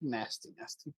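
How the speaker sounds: hushed, unvoiced nasty, nasty; whispered. Real, not acted. Echoing a friend's disgust in a whisper. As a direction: breathe the words out with no voice.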